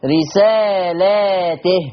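رسالاته with long laa, then ti; slow "risaalaatih" for رسالاته; Warsh plural reading. Shown as a demonstration. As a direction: stretch the lam of رسالاته, ta with i. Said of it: "وألف بينهما".

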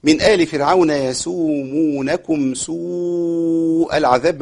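slow, measured chanting of the verse; Quran recitation. Shown as a demonstration. Teacher recites the verse for students, slow chant. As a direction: recite it calmly with long vowels.

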